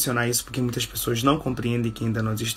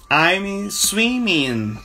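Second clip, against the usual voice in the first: very slow and higher; slow teaching model; 6 semitones higher, much slower than usual. Shown as a demonstration. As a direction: draw it out for the class.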